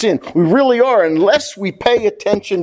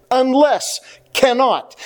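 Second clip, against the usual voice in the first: "Unless" and "cannot" each set apart, pitched higher; stress on two words; 5 semitones higher, slower than usual. Retold or imitated. Preacher showing the congregation God's key words, stressed. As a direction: land firmly on "Unless", then "cannot", each alone. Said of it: "God is stressing two words".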